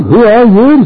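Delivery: high, loud, alarmed question; shaken, startled question. Acted. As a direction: demand it as a father taken aback. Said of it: "Isaac trembled very exceedingly".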